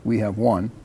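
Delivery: normal talking voice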